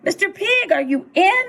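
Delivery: high singsong door call. A narrator's reading. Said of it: "I called".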